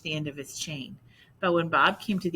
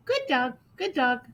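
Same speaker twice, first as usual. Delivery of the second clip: parrot voice; 9 semitones higher, 3 dB louder than usual. Acted. Parrot repeats praise.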